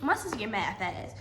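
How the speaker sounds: normal talking voice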